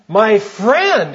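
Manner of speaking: loud, high indignant exclamation; exclaimed. Retold or imitated. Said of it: "exclaimed the old preacher".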